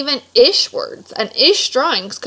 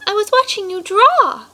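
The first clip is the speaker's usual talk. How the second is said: put-on higher, light little girl voice; little sister voice; 10 semitones higher, 3 dB louder than usual. Sister answering her angry brother, in a higher little girl voice.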